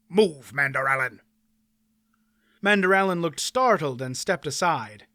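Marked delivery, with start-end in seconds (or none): 0.1-1.2 curt, firm order; stern command. A narrator's reading. Aunt Pole orders the knight out of her way, stern. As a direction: order the knight aside with no argument.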